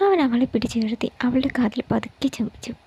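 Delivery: usual speaking voice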